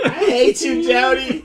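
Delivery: high, loud, laughing mock yell; mock angry girlfriend. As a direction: ham up a crying girlfriend's outburst.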